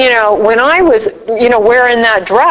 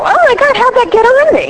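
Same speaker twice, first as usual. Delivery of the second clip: much higher, hammed-up disbelief; imitating someone looking down at her body, high mock shock; mock horror; 12 semitones higher than usual. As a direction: act baffled by your own body. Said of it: "and you go".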